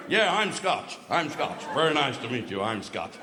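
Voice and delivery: mock American introducing himself; American accent. American greeting people as a fellow Scot, mock American accent. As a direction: repeat it like a caricatured American tourist. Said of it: "full of Yanks".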